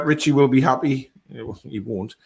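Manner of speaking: ordinary talk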